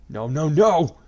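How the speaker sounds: loud anguished shout. A narrator's reading. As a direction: yell it in despair. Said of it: "Kirito shouted".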